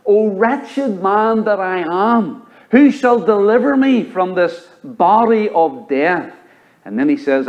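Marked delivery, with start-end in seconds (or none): 0.1-6.3 slow, higher lament; solemn scripture reading. Acted. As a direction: draw out Paul's cry slowly. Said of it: "He said this".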